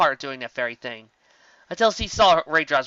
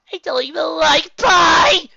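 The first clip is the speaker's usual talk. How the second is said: high, loud, slow wailing shout; despairing shout; 11 dB louder, slower than usual. A narrator's reading. Tracy tangled in pie, shouting in despair. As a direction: wail it at the sky.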